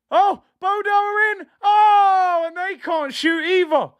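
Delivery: excited shout. Real, not acted. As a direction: shout in shock as they go through. Opponents break through on goal.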